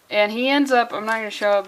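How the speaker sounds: normal talking voice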